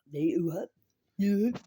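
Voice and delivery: loud mock call through the door; loud imitated wake-up call. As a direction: bellow it like barging in unannounced. Roommate barging in, calling out.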